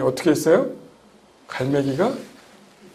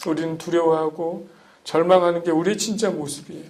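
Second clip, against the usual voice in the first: ordinary delivery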